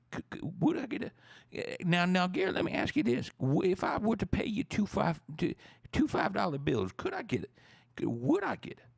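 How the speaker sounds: very high-pitched, stammering beer beggar; wheedling bar customer. Pretend. Customer haggling with Gary for a Stella Artois, high wheedling stammer. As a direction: plead in a high voice, tripping over every request.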